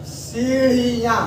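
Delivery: सीढ़ियाँ slowly with a clear ढ़; slow careful pronunciation. Shown as a demonstration. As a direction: spell out सीढ़ियाँ with the ढ़ sound. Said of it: "ये बेटा बड़ा important आ गया". (सीढ़ियाँ धीरे, ढ़ साफ़)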